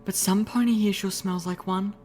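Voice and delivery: high ladylike voice, musing; cryptic musing. A narrator's reading. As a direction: muse pointedly in a high voice. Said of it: "then cryptically mused".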